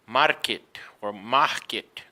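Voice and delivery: market with a tapped R, then with no R; wrong weak R. Shown as a demonstration. Teacher showing learners mistakes, market with weak or dropped R. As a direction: lose the R in market, low-pitched. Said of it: "No, it's gonna be always emphatic".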